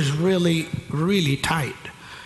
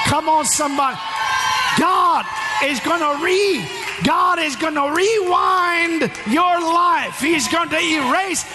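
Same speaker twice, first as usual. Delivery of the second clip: high, driving revival preacher shout; full-force preaching; 10 semitones higher than usual. Real, not acted. Preacher promising the congregation a fresh start, impassioned shouting. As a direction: build to a high, ringing cry of Glory.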